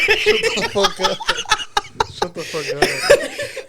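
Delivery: very high, slow, wheezed through laughter; can barely get it out for laughing; laughing disbelief. Real, not acted. Co-host hears the absurd punchline, helpless laughing disbelief.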